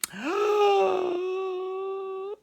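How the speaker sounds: long, high, sustained cry; high drawn-out crowd noise. Acted. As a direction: imitate the crowd in one held high note. Crowd noise after the strike call.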